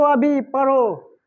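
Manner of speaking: deliberate teacher's instruction; slow command. Shown as a demonstration. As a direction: drag the command out slowly. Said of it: "या पढ़ो बोल रहा".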